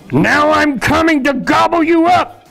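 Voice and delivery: loud, very high troll bellow; full-out hungry threat; troll roar. A narrator's reading. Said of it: "roared the troll".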